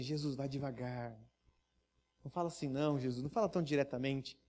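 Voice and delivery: worried friend pleading softly; hushed pleading. Pretend. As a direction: beg him quietly to tone it down. Friend begging Jesus to ease off.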